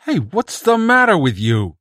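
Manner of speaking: high, loud urgent cry over the crowd; bursting in. Acted. Bedzik shouts over the crowd that the emissary lies, loud urgent warning. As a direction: cut in loudly to warn them.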